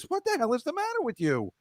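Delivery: normal talking voice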